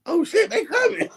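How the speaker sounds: high, laughing alarm at the rush; half-laughing yelp as they charge out; laughing panic. Retold or imitated. Watching the crew rush out, a high laughing panic. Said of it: "And I'm sitting there like".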